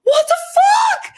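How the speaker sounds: slow, high, loud crying shriek; a hammed-up scream of horror at prison news; retold shriek. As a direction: scream it in disbelief and terror. Told she faces federal prison.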